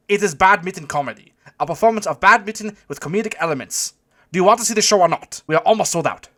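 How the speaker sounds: loud, high-pitched German impatience; thick German accent. A narrator's reading. German doorman selling tickets, loud impatient German accent. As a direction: push it out loudly in a German accent. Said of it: "call out to me in a thick German accent".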